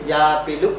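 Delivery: "yapilu": apple with a y in front and u at the end; mother-tongue "ya" onset and "u" ending on "yapilu"; Telugu-influenced pronunciation. Shown as a demonstration. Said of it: "ya influence word initial".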